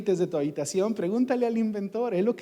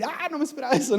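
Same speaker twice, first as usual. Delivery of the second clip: higher, mock surprised gasp of a bystander; mock surprise; 8 semitones higher, 3 dB quieter, much faster than usual. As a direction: play the caught-off-guard onlooker. Imitating people surprised by events.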